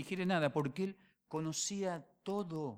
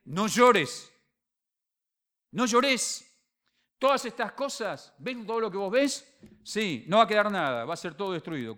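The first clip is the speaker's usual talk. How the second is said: loud proclamation; 5 semitones higher, 8 dB louder than usual. Acted. Lord comforting, warning.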